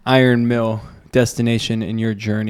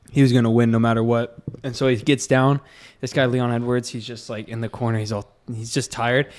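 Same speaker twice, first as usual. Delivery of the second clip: usual speaking voice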